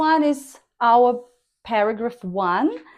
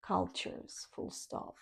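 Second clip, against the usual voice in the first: whispered dictation of Cultures, full stop; softly dictated punctuation; 4 semitones lower, 20 dB quieter than usual. Shown as a demonstration. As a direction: breathe the punctuation aside. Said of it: "This is your statement".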